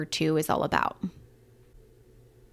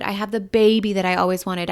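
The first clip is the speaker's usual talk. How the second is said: normal talking voice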